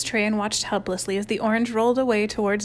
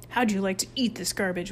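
ordinary delivery

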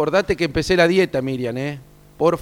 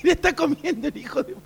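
laughing; 12 semitones higher than usual. Real, not acted. Laughing while describing eating.

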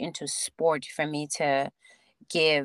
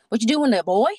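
put-on Southern accent; 5 semitones higher, 8 dB louder, faster than usual. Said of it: "he's talking in this Southern accent".

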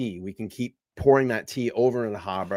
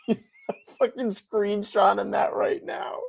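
high and slow, words stretched through a laughing shriek; 10 semitones higher than usual. Real, not acted. Cohost quoting the host's reaction, shrieking laugh.

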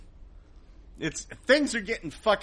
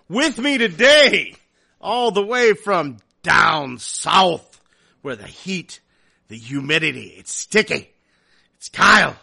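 announcer intro; 9 dB louder than usual. Acted. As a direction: hype up the guest like a ring announcer.

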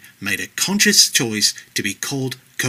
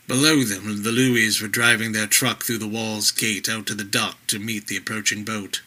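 usual speaking voice

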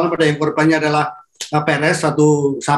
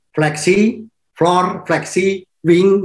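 slow emphasis; 2 semitones higher, much slower than usual. Acted.

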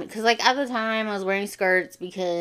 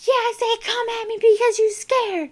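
high, raised-voice mock defiance; very pitched-up challenge in a retold quarrel; retold defiant comeback; 14 semitones higher, 4 dB louder than usual. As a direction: act out yelling back at a bully. Acted quarrel, shouts back.

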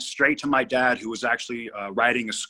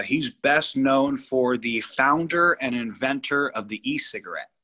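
usual speaking voice

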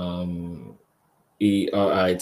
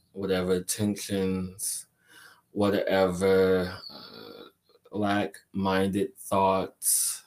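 guided meditation. Acted. Leads a crystal energy meditation.

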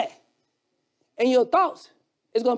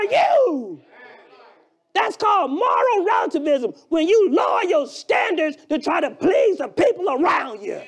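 preaching at full force; 4 semitones higher than usual. Acted. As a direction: preach it hard to the congregation.

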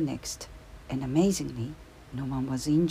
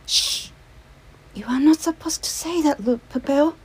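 urgent hushing whisper, higher; hushed whisper; 12 semitones higher, 10 dB louder than usual. A narrator's reading. Lubici stopping Popel from telling the secret, urgent whisper. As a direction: hush him before he tells.

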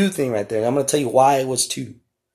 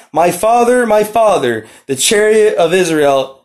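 raised, ringing cry of grief, much higher; 6 semitones higher, 5 dB louder, slower than usual. Acted. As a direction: call out to the departing father. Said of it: "and he cried out".

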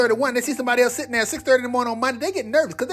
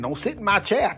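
a lightly voiced church lady, low; a hint of a stern church lady; 3 semitones lower than usual. Church lady warning off newcomers, lightly voiced.

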